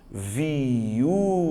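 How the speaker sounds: VIÚVA stretched slowly into vi-ú-va; exaggerated syllable-by-syllable "viúva"; drawn-out syllables. Shown as a demonstration. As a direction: sound out "viúva" in three long syllables, I and U apart. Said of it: "Se você for pronunciar".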